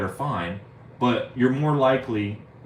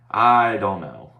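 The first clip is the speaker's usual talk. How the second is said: louder, slow drawn-out I don't know; 7 dB louder, slower than usual. Shown as a demonstration. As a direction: stretch it out loud and slow.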